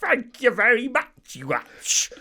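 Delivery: loud, high, drawn-out Cockney caricature; hammed-up stage Cockney; mock Cockney accent. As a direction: belt out a terrible, exaggerated Cockney. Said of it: "In that language would be like".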